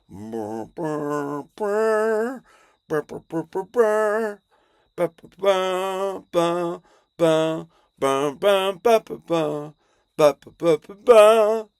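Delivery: loud imitated brass theme, building; mouthed movie fanfare. The movie starts, loud building imitated fanfare. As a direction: blare out a film-intro fanfare with the lips. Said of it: "the movie is like".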